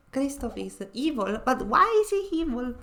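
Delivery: ordinary talk, nothing marked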